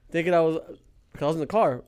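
ordinary delivery

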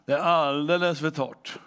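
usual speaking voice

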